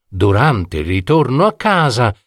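plain reading voice

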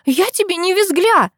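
very high, outraged retort; indignant squeal. A narrator's reading. Girl objecting to a mocking nickname, indignant squeal. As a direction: squeal in offence without much extra volume. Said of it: "визжит она возмущённо".

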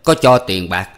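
father haggling over selling his son, pointed; father Hữu. A narrator's reading. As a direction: press the old man about the money. Father bargains with old man.

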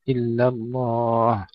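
drawn-out إلا الله with dark lam in الله; light then heavy lam. Shown as a demonstration. Tajweed teacher repeating the example, slow heavy الله after light إلا. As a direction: stretch الله full after a thin إلا. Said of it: "وتفخيمها في لفظ الجلالة".

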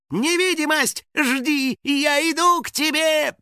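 high, loud gleeful cry; overjoyed. A narrator's reading. House spirit rushing at the artefact, overjoyed. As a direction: call out high, beside yourself with joy. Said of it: "Вне себя от радости".

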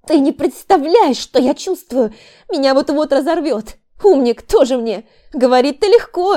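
high, loud, angry yelling; labouring wife yells at husband, high and loud; furious cries in pain. A narrator's reading. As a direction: lash out at him through the pain. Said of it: "стонала девушка".

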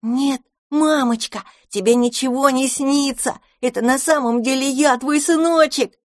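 put-on high, bright child voice full of joy; glad kitten. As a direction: announce it happily to mum. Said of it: "радостно сообщил".

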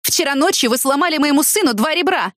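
high-pitched outraged accusation; yelling. A narrator's reading. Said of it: "сразу закричала на него".

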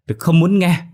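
high, loud outburst of refusal; screaming. A narrator's reading. Hoa driving the wife from her room, screaming. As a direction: scream it at the peak of rage. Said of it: "Hoa ôm tay la hét".

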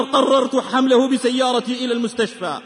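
ordinary talk

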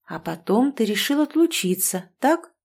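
plain reading voice

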